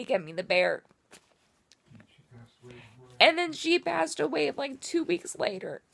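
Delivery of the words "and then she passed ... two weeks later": fighting back real tears as she talks; tearful. Real, not acted. Telling viewers about her late mom's last gift, high tearful voice. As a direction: voice climbing high and choking up, close to tears. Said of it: "I'm being a big baby today".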